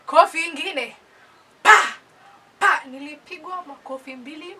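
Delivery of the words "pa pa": loud, high "pa!"; imitated slap sound. Mother's two hot slaps, sharp loud imitated smacks. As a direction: punch out two slap noises.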